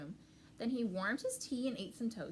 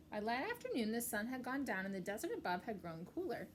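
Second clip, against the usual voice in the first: ordinary delivery, nothing marked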